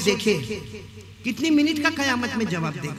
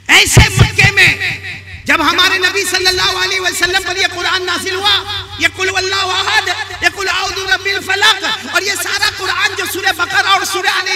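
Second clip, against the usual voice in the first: high, driving sermon narration; full-force preaching; 11 semitones higher, 7 dB louder than usual. Real, not acted. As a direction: proclaim the story to a big crowd. (बुलंद, जोशीली तक़रीर)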